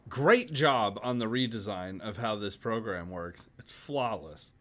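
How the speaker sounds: mock praise, hitting "great job" hard; dry sarcasm, tailing off on "flawless"; sarcastic. Real, not acted. Host thanking the streaming software for its glitchy redesign, sarcastically. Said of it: "Can you hear the sarcasm?".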